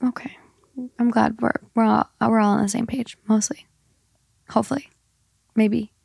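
soft whispered aside. Real, not acted. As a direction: whisper it under your breath.

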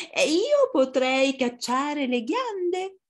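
high, slow fox voice; dreamy storybook fox, high and drawn out; long fox. Acted.

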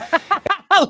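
very high, loud burst of laughter; shrieking laugh. Real, not acted. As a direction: crack up at the sight. Reacts to the studio audience.

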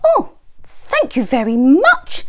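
high squawky hen voice; squawking hen. A narrator's reading. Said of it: "squawked Mother Hen".

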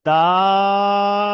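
দাল drawn out long and loud, three-beat vowel; long drawn dal. Shown as a demonstration. Teacher demonstrating দাল to students, drawn out. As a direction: stretch দাল like a Quran teacher. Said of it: "তারপরে দুটো অক্ষর দেখেন".

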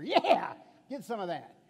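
loud gloating cheer; triumphant hammed-up whoop; mock victory yell. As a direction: shout it like you won the World Series. Mock victory celebration over checkers.

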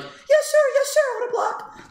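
very high squeaky yes-sir voice; mock servile voice. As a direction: squeak it like a groveling servant. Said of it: "you'll be like".

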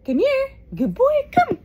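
high-pitched pet-talk; calling a pet. Real, not acted. Owner summoning the dog on camera, high coaxing call.

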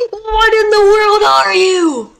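high, slow frightened cry; shocked cry. Acted. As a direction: cry out in horror. Sees alien again.

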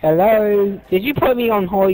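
ordinary delivery

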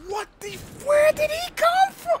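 high-pitched, alarmed outcry; startled yelp. Real, not acted. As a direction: cry out in shock, voice shooting way up. Caught off guard by an enemy.

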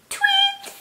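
extremely high tweet; bird tweet. Shown as a demonstration. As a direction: chirp like a tiny bird. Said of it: "make some of these noises".

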